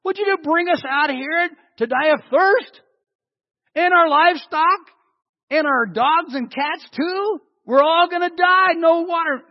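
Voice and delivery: high, loud whining complaint; hammed-up panicky moaning crowd; whiny griping. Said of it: "they're griping to Moses and they say".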